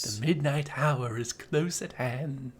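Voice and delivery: slow, drawn-out horror narrator; Vincent Price. Acted. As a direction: draw it out like an ominous narrator. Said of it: "Vincent Price narrating the thing".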